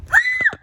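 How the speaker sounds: short, high, loud shriek; excited scream. Real, not acted. Vlogger opening a box, short excited scream. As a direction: scream at the sudden reveal.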